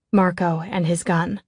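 usual speaking voice

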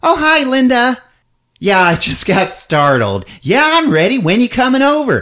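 put-on high, chirpy phone voice of a startled young woman; mock ingenue, high and bright; high girly voice. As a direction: answer the phone like a ditzy heroine. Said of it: "She said".

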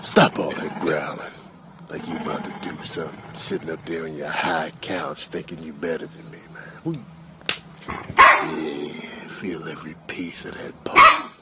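very low, soft and deliberate; deep, quiet threat; menacing taunt. Acted. Low voice scorns growling dog.

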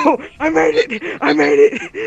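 relieved laughter. Real, not acted. Escaped through the cornfield.

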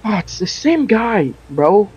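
normal talking voice

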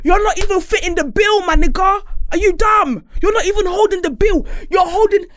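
high loud indignant yell; outraged shout. Real, not acted. Yelling at stingy man.

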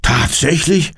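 high, alarmed question; startled. A narrator's reading. Resident checking the neighbour's report of an intruder, startled. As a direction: ask it in startled disbelief. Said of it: "ruft der Mann erschrocken".

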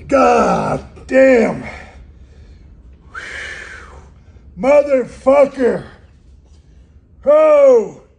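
loud cursing from the icy shock; cold shock shouting. Real, not acted. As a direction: yell out at the freezing water. Just plunged into icy water.

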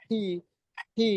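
হি with air flowing out; breathy hi. Shown as a demonstration. Teacher showing students the breathy letter. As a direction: breathe হি out airily. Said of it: "বাতাস বের হবে".

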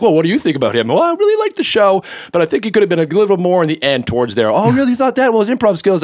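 two high-pitched cartoonish voices in dialogue; character voices. Auditioning by talking to himself, exaggerated character voices. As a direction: argue with yourself in silly high voices. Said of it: "every character voice, I did conversations with myself".

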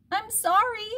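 put-on very high-pitched small child voice; little Harriet. A narrator's reading. Harriet apologizing to her mother, high child voice. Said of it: "said Harriet".

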